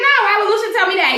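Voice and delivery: high, fast defensive denial; quick mock denial. Esperanza denying Lucian told her, high and fast.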